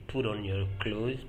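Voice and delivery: usual speaking voice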